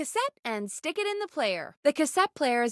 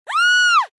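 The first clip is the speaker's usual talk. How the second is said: sudden piercing high scream; startled scream; 9 dB louder than usual. Acted. Radio host startled by an explosion, piercing scream. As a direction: scream at the blast.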